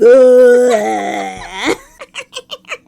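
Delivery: a big burst of laughter; loud real laughter after the scary line. Real, not acted. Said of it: "That's funny".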